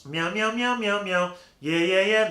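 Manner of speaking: high, loud nasal meowing; nasal meows. Shown as a demonstration. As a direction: buzz it through the nose like a cat. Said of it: "kind of in the nasal area right here".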